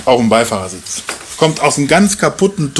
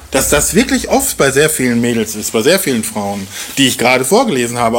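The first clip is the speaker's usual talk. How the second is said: usual speaking voice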